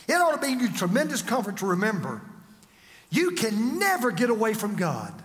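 usual speaking voice

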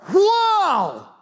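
high delighted wow; amazed wow. Shown as a demonstration. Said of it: "Sometimes he's amazed like".

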